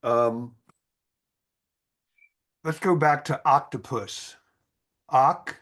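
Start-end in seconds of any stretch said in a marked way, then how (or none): 5.1-5.6 OC said as "ock", louder; short O. Shown as a demonstration. Teacher breaking down octopus, OC with a short O. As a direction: punch OC with a short O. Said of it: "kind of a short O".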